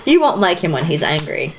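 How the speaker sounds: ordinary talk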